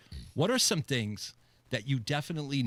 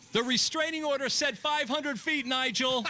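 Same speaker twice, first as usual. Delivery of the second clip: mock shout; 11 semitones higher than usual. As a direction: call out to Nigel with exasperation. Acted pool party call-out.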